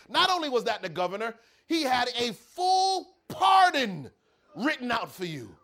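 can't hold back the news; gleeful reveal. Acted. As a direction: warden gloating over the pardon. Warden gleefully reveals the pardon.

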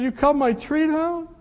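high, loud disbelieving question; stunned disbelief. Retold or imitated. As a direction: ask it as if you can't believe it. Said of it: "He said".

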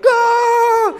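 sudden high shriek of panic; scream of fright. Retold or imitated. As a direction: shriek like a rat just popped out at you. (grito agudo de susto)

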